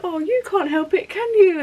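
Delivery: high sing-song cooing to a dog; imitating a doting dog lover; cooing pet talk. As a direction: coo sympathetically, pitch way up. Lady stroking dog, talking to it.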